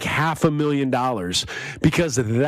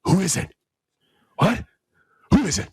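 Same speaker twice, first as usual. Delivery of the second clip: sudden alert; 6 semitones higher, much faster than usual. Pretend. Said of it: "all of a sudden you go".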